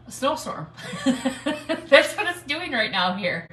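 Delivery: slow, laughing through the words. Real, not acted. Telling viewers about the snowstorm outside, laughing. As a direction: chuckle as you tell it.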